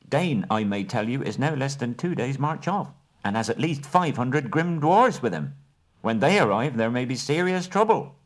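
high, precise hobbit negotiator; business manner. Acted. Bilbo bargaining with the Elven King and Bard, businesslike. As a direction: lay out terms like a careful merchant.

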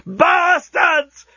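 put-on old genius yelling at the builders. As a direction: yell it at the unfinished works. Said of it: "he yelled".

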